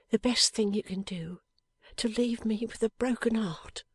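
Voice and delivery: lightly voiced young wife, tender and earnest; a hint of Lizzie. A narrator's reading. Young wife answering her dying husband, gently earnest.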